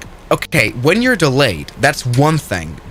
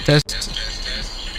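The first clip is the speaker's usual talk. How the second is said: whispered mic test; 2 semitones higher, 7 dB quieter than usual. Shown as a demonstration. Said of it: "let's try it like that".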